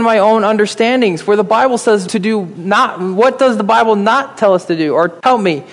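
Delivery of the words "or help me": ordinary talk, nothing marked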